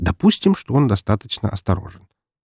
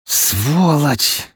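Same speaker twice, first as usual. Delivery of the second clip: higher-pitched, drawn-out seething curse; angry hiss; 7 semitones higher than usual. A narrator's reading. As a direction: spit the word out, trembling with rage. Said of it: "прошипела она".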